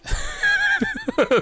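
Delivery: very high mock evil cackle; villain cackle. A pretend creature cackling at the audience. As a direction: cackle like a gleeful little monster.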